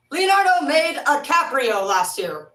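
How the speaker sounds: hammed-up loud slow repeat; loud drawn-out joke. Real, not acted. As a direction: belt out the flubbed name for laughs. Repeats the flubbed name as a joke.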